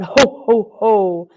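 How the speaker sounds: a big hearty Santa laugh; loud Santa ho ho. Acted. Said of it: "Laughed loud".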